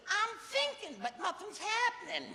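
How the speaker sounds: very high, loud comic stooge voice; Curly. Acted. Curly answers Mo about thinking, high comic impression. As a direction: whine it out loudly in a cartoonish squeal. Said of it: "And Curly goes".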